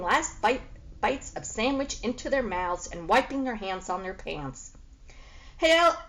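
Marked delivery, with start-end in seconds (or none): 5.6-6.0 a loud, fast, high call across the docks; yelled. A narrator's reading. Dockhand yelling for the boy to come work. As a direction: shout his name to get him over here. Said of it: "someone yelled".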